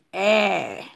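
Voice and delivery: E stretched long as an open è; open vowel. Shown as a demonstration. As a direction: hold E like E with a grave accent. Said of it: "ou di E". (E long, tankou è)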